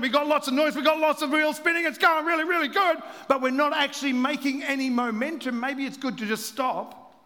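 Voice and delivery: loud, high, driving sermon climb; full-force pulpit build; preaching at full force. Acted.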